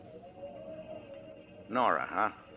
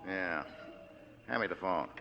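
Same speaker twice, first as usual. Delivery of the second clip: normal talking voice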